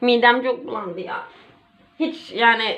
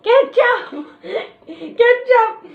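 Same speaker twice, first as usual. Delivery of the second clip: excited; 12 semitones higher, 3 dB louder, much slower than usual. Real, not acted. Excited call, laughing.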